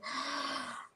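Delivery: a sharp, voiceless startled intake of breath; imitated gasp. Cousin spots an aura in the spa waiting room, imitated gasp. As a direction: mimic the cousin's shocked gasp. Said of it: "she looked at me and she gasped".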